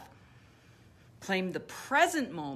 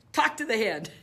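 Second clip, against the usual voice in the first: sassy dismissal; 2 semitones higher, 6 dB louder than usual. Shown as a demonstration. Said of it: "So call it out for the name".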